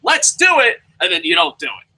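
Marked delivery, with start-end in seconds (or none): 0.0-0.8 high, loud mock gung-ho cry; hammed-up rallying call; acted eager agreement. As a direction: shout it as an over-keen yes. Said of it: "I say".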